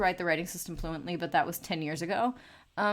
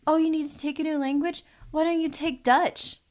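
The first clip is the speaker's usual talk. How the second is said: high, bright mocking impression of a classmate; high-pitched mock schoolmate voice; 10 semitones higher, 7 dB louder than usual. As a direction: parrot the classmate's chirpy suggestion. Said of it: "Somebody at school was like".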